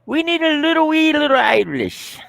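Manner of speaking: very high-pitched cartoon Irish voice; hammed-up Irish, way up in falsetto; mock Irish accent. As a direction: squeal it like a comic Irishman. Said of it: "I like Irish accents".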